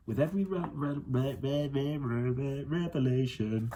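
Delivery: stumbling over re-, re-, before revelation; stuck on a word. Real, not acted. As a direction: reader trips on the word. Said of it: "With each revelation".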